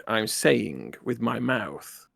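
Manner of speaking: each word laid down slowly; slow pointed comeback to the engineer. Acted. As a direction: spell it out deliberately, word by word. Captain's pointed slow retort.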